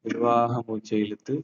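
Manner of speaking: slow, soft breathing guide; soft spa whisper. Acted. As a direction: hush it, guide each breath gently. Leads breathing exercise.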